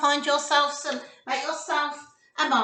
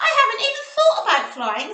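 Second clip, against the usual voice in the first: Zippo voice; 8 semitones higher, 7 dB louder, faster than usual. Acted. Zippo voiced in read story.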